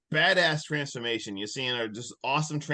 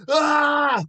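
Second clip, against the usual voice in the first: high, loud drawn-out yell; imitated yell; 13 semitones higher, 8 dB louder than usual. Retelling the yell as he kills her, imitated yell. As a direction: let out a comic scream. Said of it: "he literally yells".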